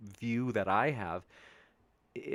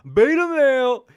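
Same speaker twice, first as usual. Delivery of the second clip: Jesse Lee impression; 14 semitones higher, 12 dB louder than usual. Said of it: "imitating Jesse Lee's voice".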